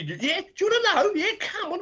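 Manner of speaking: high, mock tough challenge with a laugh; high mock fighting talk to skinheads; mum squaring up. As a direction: goad them like a fearless tiny mum.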